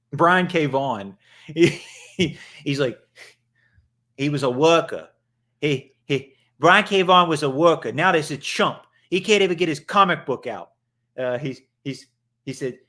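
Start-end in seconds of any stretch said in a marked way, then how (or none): 4.2-10.7 higher, louder New York-tinged Boston ranter; bad Boston accent, ranting like an unhinged critic; attempted Boston accent. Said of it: "that's not even a Boston accent".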